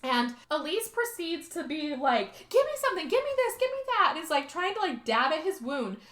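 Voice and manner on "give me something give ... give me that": very high, urgent acted pleading; mock frantic demands. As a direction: grab for anything, in pretend panic. Retold frantic tending of a wound.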